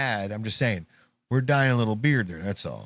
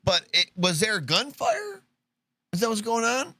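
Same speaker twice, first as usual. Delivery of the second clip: slightly mock-polite, higher-pitched bystander; lightly acted anxious politeness; 7 semitones higher than usual. Host as bystander blessing a sneezer during gunfire, lightly mock-polite. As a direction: lightly play a courteous man trying to get an answer.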